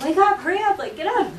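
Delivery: high, loud hurry-up; urgent wake-up call. Shown as a demonstration. Telling someone to wake up, loud and urgent. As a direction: rouse a sleeper fast. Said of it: "So this can be like".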